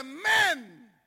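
loud one-word hail; calling out. Acted. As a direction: shout it as a summons. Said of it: "le dice".

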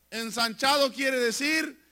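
usual speaking voice

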